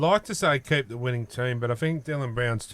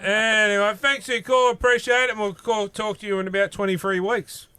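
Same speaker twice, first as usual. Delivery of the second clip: upbeat sign-off; 8 semitones higher, 5 dB louder than usual. Real, not acted. Host loudly wraps up the call.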